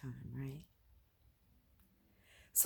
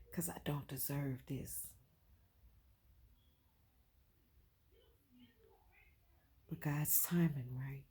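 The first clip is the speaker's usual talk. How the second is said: whispered, barely voiced confession; 4 dB quieter than usual. Real, not acted. Vlogger admitting to viewers she doesn't deserve this, in a whisper. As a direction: breathe it out as a hushed aside.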